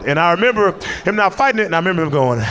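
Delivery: ordinary talk, nothing marked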